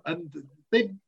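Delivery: ordinary talk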